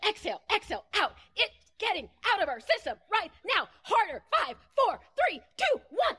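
high, punched staccato commands; forceful exercise countdown, pitch rising; urgent coaching. Shown as a demonstration. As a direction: drive the group harder, climbing to a peak. Leads forceful anger-release breathing exercise.

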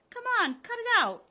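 high, loud, quick yell; sharp frantic objection; alarmed protest. Acted. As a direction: yell for him to stop, voice leaping up. Wilma protests being shoved.

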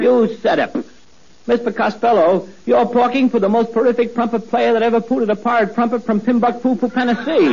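"Mister" said "Mispa", "Costello" said "Caspello". Acted. Boy boasts to Costello, T said as P. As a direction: brag while every T comes out a P. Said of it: "He makes it sound like a poof".

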